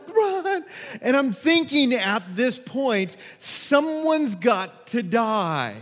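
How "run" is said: very high, urgent cry; acted urgent yell. Leader pushing girl away from bear, acted urgent yell. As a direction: yell at her to keep moving.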